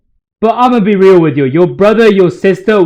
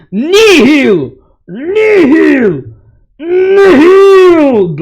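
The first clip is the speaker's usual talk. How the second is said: rising, ever louder screamed chant; Nihil battle cry; 9 semitones higher, 3 dB louder, much slower than usual. Retold or imitated. Imitating Morg's screamed battle cry for viewers. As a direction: scream it like a cult leader's war cry. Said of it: "I yelled that shit just now".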